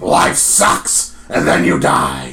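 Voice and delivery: low, slow, forceful promoter growl; deep, drawn-out shouted impression; Vince McMahon. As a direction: do a hammed-up wrestling boss, dragging each word. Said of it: "if I really try to do".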